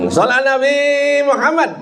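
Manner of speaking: high-pitched call to the congregation; salawat call. Real, not acted. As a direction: call out high for the crowd's response. (seruan selawat bernada tinggi)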